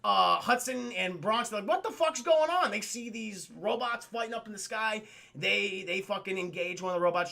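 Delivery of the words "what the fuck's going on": higher-pitched, baffled outburst; mock bewilderment. Gargoyles reacting to the robots, bewildered. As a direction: exclaim it in put-on disbelief.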